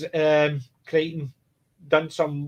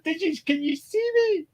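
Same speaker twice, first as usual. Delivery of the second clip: pleading cat; 12 semitones higher than usual. Retold or imitated. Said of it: "he's going".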